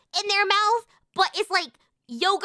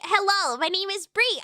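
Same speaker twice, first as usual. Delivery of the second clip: normal talking voice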